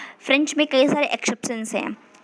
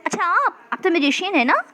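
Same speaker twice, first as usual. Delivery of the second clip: high, surprised rising question; pitched-up "oh, aren't you?" lilt; asking in recognition; 6 semitones higher than usual. Acted. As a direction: act out placing a familiar face. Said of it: "हम literally किसी से पूछते हैं ना".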